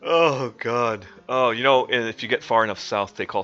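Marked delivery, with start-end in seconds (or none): none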